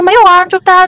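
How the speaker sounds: insisting nothing was wrong; mock indignant protest. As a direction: retell her own protest to the friend. Retorting to friend who waited outside.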